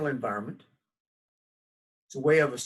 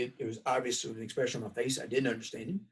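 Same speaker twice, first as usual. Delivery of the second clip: ordinary delivery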